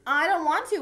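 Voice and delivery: acted whiny refusal; whining. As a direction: protest in a whiny frog voice. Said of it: "whined Froggy".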